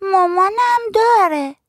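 put-on very high, drawn-out little kid; small child's voice. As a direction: stretch it out in a high kiddie voice. Narrator voices small child answering.

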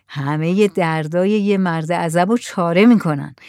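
plain reading voice

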